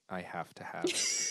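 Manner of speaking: slow, soft robotic imitation. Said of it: "you said it like a robot".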